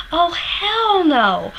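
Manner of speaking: high, drawn-out slow outrage; outraged disbelief. Retold or imitated. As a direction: stretch it out in disgusted disbelief. Furious at singer's wrong verse.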